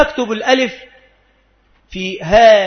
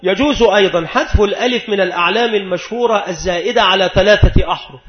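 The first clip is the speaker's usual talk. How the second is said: ordinary delivery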